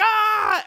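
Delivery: high short mock scream of fading fighters; imitating a scream while vanishing. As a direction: yelp it out way up high. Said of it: "They're screaming".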